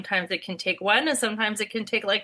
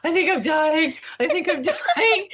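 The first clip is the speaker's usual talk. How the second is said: mock dying whine; 7 semitones higher, 3 dB louder than usual. On couch, sure she's dying.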